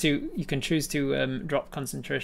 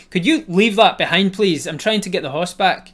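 higher, louder plea; exasperated plea; 5 semitones higher, 10 dB louder than usual. Acted. Rider begs the sprite to leave the gorilla behind, louder. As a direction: plead with her, fed up. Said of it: "And you're like".

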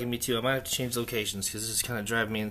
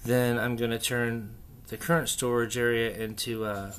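ordinary delivery

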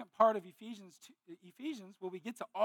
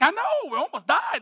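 shaken relief; 7 semitones higher, 8 dB louder than usual. Retold or imitated. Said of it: "I'm like".

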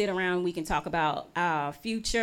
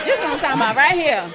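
fast, laughing, louder; 4 semitones higher, 8 dB louder, much faster than usual. Real, not acted. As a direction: rattles it off through a laugh.

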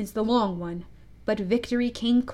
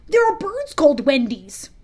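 eager recall; 4 semitones higher, 6 dB louder than usual. A narrator's reading. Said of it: "said Slightly instantly".

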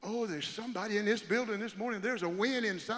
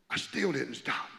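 ordinary talk, nothing marked

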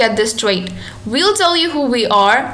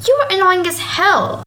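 high, offended outburst; indignant; 6 semitones higher than usual. Acted. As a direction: snap back at him. Acted quarrel.

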